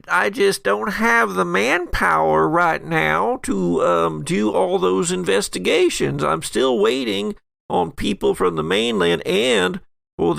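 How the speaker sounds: nasal deputy. Acted. Uncomfortable deputy excuses himself.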